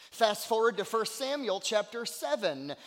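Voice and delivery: high, driving sermon delivery; forceful preaching. Real, not acted. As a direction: preach it urgently, stretching the key words.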